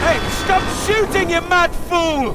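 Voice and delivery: loud, high furious yelling; angry shout. Acted. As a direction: scream at him to stop shooting. Yelling at someone shooting.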